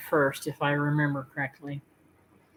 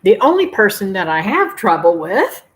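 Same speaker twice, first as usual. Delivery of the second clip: mock tease; 9 dB louder than usual. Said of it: "I'm teasing".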